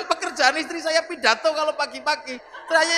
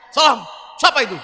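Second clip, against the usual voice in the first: gruff shout; 7 dB louder, faster than usual. Acted. Umar barking at the angels.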